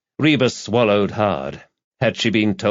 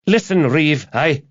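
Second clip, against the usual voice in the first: high-pitched, urgent blurt; panicked; 7 semitones higher, 4 dB louder than usual. A narrator's reading. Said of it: "she had panicked on hearing Samantha's cry".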